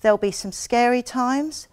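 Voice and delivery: ordinary talk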